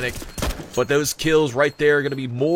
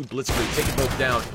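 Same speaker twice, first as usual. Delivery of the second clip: ordinary talk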